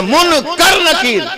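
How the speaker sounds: मुनकर drawn out high and loud as "mun-kar"; emphatic correct pronunciation. Shown as a demonstration. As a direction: hammer out मुनकर with an a in the second syllable. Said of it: "मुनकिर कहां से आ गया".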